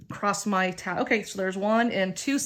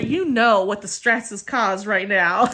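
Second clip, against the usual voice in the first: ordinary delivery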